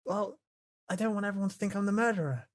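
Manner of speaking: ordinary delivery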